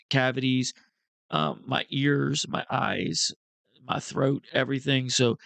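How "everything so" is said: normal talking voice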